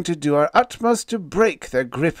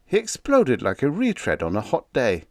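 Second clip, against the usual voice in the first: ordinary delivery, nothing marked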